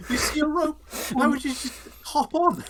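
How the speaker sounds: high, incredulous mock cry; mock bewildered onlooker. Friend mocking the Doctor's rooftop stunt, high incredulous voice. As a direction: squeal it in disbelief.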